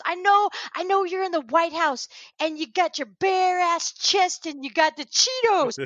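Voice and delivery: high, indignant imitated tirade; Michael Rapaport. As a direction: act out a man losing it, stretching the insults. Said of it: "just losing his shit".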